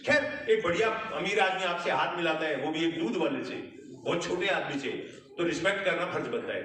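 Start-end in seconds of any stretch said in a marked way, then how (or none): none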